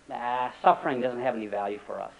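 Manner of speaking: ordinary talk, nothing marked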